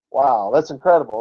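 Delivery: ordinary talk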